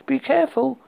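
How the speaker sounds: high, anxious plea; worried. A narrator's reading. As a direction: call out a frightened warning. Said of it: "Julius cried and said".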